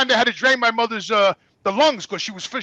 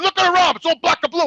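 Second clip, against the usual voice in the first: higher, indignant complaint; angry protest; 7 semitones higher than usual. Retold or imitated. Son confronting the nurse about mother's arm, angry protest. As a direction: point out the damage in outrage. Acted quarrel with hospital nurse.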